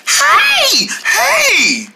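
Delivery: high, eager shout; excited call. Acted. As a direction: call out happily to a friend. Greeting friend in diner.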